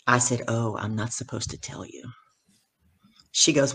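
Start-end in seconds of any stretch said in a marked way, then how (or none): 0.5-2.0 low, soft retold aside; hushed confession. New speaker admits a secret to first speaker, hushed. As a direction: drop the voice as if sharing a secret.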